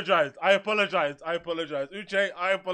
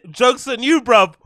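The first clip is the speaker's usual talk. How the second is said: normal talking voice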